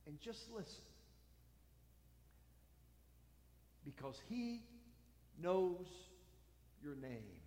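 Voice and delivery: quiet pastoral intimacy; hushed sermon. Acted. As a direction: lean in and say it quietly.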